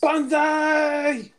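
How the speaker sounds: high, drawn-out war cry; mock battle yell. Friend finishing Steve's tale as he yells, mock battle yell. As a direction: let the cry ring out in mock heroics. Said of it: "And he yells".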